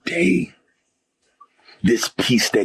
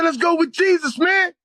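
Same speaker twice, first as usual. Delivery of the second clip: high, fervent retold shout; rally him in a very high voice; fired up. Urging his friend towards Jesus, retold fired-up shout. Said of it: "I was fired up".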